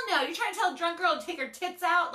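indignant and half-laughing; outraged laughing. Retold or imitated. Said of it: "I was like".

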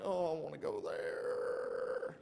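low, drawn-out yearning; wistful longing. Retold or imitated. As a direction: pine for it slowly in a low voice. Retold longing for the hotel.

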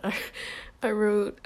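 ordinary talk, nothing marked

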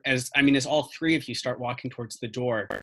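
normal talking voice

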